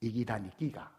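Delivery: "이기다 니끼가" said quietly in Gyeongsang dialect, rising at the end; lightly voiced, muttered Gyeongsang retort. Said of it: "눈을 부라리며 그 사람에게 딱 이렇게 말했습니다".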